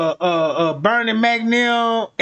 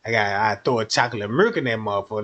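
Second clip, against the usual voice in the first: put-on much lower kid voice haggling; low gruff haggler; 12 semitones lower, 3 dB quieter, faster than usual. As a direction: growl out the counteroffer low. Kid sweetening the deal.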